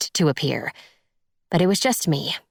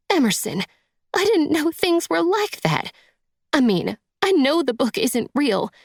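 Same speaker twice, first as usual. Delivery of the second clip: tearful woman pitched way up; acted weepy, disbelieving stepmother; high-pitched, near sobbing; 10 semitones higher than usual. A narrator's reading. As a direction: plead high, sobs threatening. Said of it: "high-pitched voice as sobs threatened".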